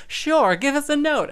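high, loud, grand reply; hammed-up diva. Stars agreeing to sing, high loud mock diva. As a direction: toss it off like a showbiz diva. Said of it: "they all go".

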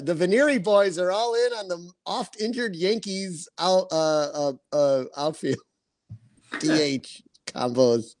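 ordinary talk, nothing marked

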